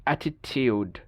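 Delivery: British অ্যাটিটিউড ending in TYOOD; Y glide in অ্যাটিটিউড; British pronunciation. Shown as a demonstration. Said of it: "ব্রিটিশরা বলে দেখেন". (অ্যাটিটিউড-এ ইউ, British)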